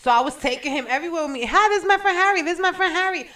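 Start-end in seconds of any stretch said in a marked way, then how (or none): 1.5-3.2 put-on high, bubbly party-girl voice, introducing her friend; a tipsy girl proudly shows off her friend; tipsy bubbly intro. Introducing drunk friend Harry around.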